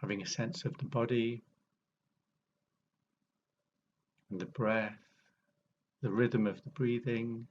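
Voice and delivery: unhurried meditation guide, long pauses between phrases; guided meditation. Real, not acted. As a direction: ease listeners into awareness, phrase by phrase, leaving space.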